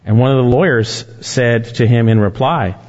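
ordinary delivery

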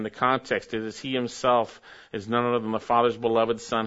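normal talking voice

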